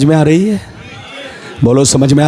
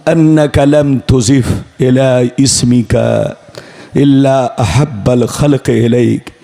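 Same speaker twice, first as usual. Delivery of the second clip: much lower, measured reciting voice; Arabic recitation; 8 semitones lower than usual. Acted. Preacher recites Adam's words to Allah, low measured recitation. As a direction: drop low and intone it evenly.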